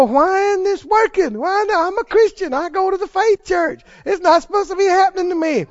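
mock upset believer whining; mock whiny complaint. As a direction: complain like a let-down churchgoer. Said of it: "start crying and get all upset".

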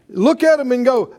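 normal talking voice

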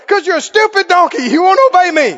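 high, loud exasperated scolding; comic play-acted telling-off; mock angry retort. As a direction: argue back loudly, hammed-up. Acted quarrel, Balaam scolds again.

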